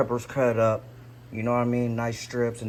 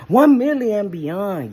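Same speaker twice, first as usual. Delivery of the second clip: hyped; 10 semitones higher, 8 dB louder than usual. Real, not acted. Hyping his subscriber goal.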